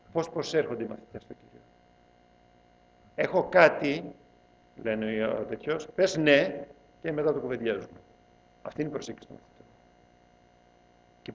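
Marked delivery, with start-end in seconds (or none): 3.1-4.1 mock demanding. Disciples voiced, mocking. 5.9-6.7 mock demanding. Disciples voiced, mocking.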